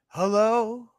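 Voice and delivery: calling out. Real, not acted. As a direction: call out for a lost connection.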